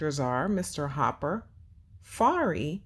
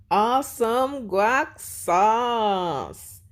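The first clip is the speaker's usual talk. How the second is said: slow, loud, higher cheer; excited cheer; 6 semitones higher, 5 dB louder, much slower than usual. Acted. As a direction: cheer the kid on. Mascot cheers right answer.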